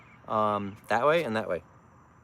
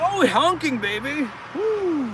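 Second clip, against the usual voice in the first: high, loud cheer at a honk; high delighted yell; excited whoop; 14 semitones higher, 4 dB louder, slower than usual. Real, not acted. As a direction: celebrate the honk. Car honks back, delight.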